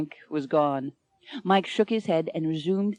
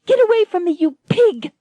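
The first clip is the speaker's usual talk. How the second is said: much higher, louder furious cry; furious outburst; 11 semitones higher, 7 dB louder than usual. Acted. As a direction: spit it at him in disgust. Lady bites pirate, repels him.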